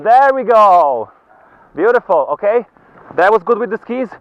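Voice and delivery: high, loud encouraging shouts; cheery ski instructor shouting. Real, not acted. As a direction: cheer the skier on from uphill. Instructor calling to skier on slope.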